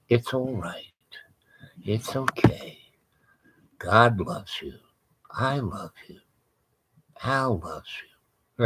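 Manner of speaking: hushed, gentle soothing; soft soothing voice. Retold or imitated. Wife softly soothing a demon, hushed and gentle. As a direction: coo it softly like calming a frightened animal. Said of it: "I hear her voice going".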